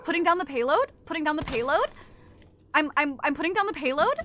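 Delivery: every line "payload?" ending on a rising uptalk tune; mock uptalk, rising at the end; question accent. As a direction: say "Putting down the payload?" as if asking. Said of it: "talk in a question almost".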